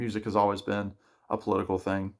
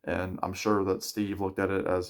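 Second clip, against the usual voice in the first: normal talking voice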